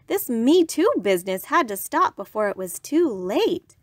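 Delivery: ordinary delivery, nothing marked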